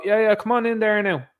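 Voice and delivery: mock eager official. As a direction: lightly send up an eager pushover official. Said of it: "our clown show will go".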